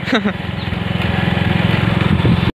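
breathy chuckle at his own joke; short laugh. Real, not acted. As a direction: let out a quick amused laugh.

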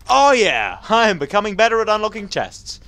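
loud, high crowing; triumphant boast. Real, not acted. Gamer after unlocking a chest, triumphant.

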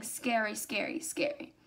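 breathy ghost-story hush; spooky whisper. A narrator's reading. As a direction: whisper it to give a shiver. Spooky repetition of scary.

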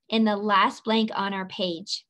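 ordinary talk

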